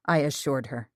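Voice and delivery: usual speaking voice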